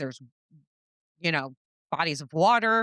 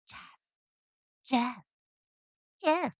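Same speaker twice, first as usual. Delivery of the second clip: high, drawn-out calling of a name; ghost child voice; 7 semitones higher, 4 dB quieter, slower than usual. Shown as a demonstration. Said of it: "I'm trying to be a kid right now".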